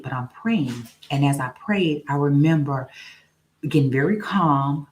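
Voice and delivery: normal talking voice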